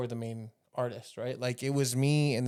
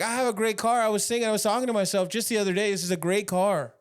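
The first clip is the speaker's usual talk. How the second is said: loud, higher, fast bragging Trump; a Trump brag, loud and quick; Trump impression; 6 semitones higher, 4 dB louder, faster than usual. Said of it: "He's like".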